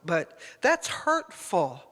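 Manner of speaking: ordinary talk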